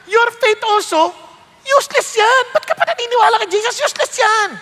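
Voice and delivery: high, mocking voice of a doubter; mocking skeptic. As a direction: scoff at faith like a cynic. (mapanuyang boses ng nagdududa)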